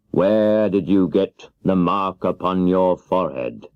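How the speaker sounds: very slow, booming wizard question; slow, grave wizard voice; the great Oz. Acted. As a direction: draw out each word like a giant head. Oz questions Dorothy.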